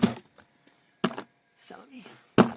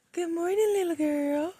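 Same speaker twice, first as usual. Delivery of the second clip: high pet voice; 7 semitones higher, faster than usual. Real, not acted. Greeting the dog in the morning.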